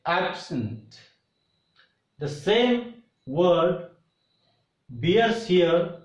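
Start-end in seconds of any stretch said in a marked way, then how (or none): 0.0-1.0 "absent" with a strong AB and weak sent; stress on first syllable. Shown as a demonstration. Teacher shows the class the noun absent, stressed on AB. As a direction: hit AB in "absent", let the second syllable shrink. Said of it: "this word is pronounced like".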